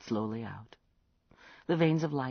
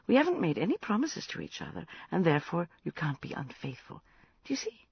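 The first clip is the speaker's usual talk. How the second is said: lightly raised, brisk voice of a sleepy woman; patient but firm with a drunk friend; a hint of exasperated kindness; Catherine voice; 8 semitones higher, 4 dB louder than usual.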